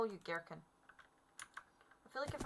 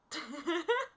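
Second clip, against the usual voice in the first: short high chuckle; quick, rising laugh; 6 semitones higher than usual. Real, not acted. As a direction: burst of giggling after a mishap. Laughs after the fall.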